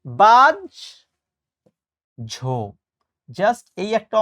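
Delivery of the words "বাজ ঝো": bajjho, the ja doubled; correct pronunciation. Shown as a demonstration. Teacher showing বাহ্য as বাজ্ঝো. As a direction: say বাজ ঝো with a held doubled j. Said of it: "বাস্তব জীবনে আমরা কি বলি?".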